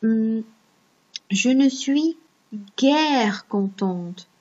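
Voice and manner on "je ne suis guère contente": slow, careful reading with guère drawn out; teacher stretching "guère" so pupils catch it; slow example sentence. Shown as a demonstration. Teacher reading an example to pupils, slow and careful. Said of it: "si je dis".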